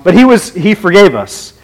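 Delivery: usual speaking voice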